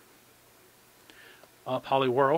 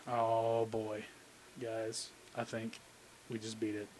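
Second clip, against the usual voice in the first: awed hush; 4 semitones lower, 11 dB quieter than usual. Real, not acted. Awed at a good pull.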